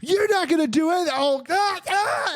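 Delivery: high-pitched mock panicked yell. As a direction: yell it high, in a strained play-acted voice. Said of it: "he's yelling, and he's like".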